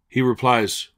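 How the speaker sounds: ordinary delivery, nothing marked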